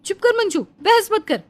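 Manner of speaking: ऊँची आवाज़ में तुनकती जवान बहू; सास का पक्ष लेकर पड़ोसन को ऊँची आवाज़ में ललकारो; बहू प्रिया की आवाज़. Acted. (पड़ोसन से भिड़ती बहू, ऊँची तीखी आवाज़ में)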